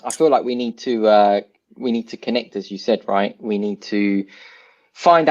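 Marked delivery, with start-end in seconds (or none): none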